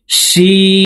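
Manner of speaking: শিন with breath pushed out on the sh, said high and long; teacher demo of শিন, air flowing out; airy shin. Shown as a demonstration. Teacher shows students airy শিন. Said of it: "বাইরে বাতাস বের করে উচ্চারণ করব".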